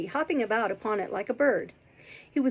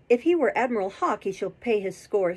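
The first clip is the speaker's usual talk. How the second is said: ordinary delivery, nothing marked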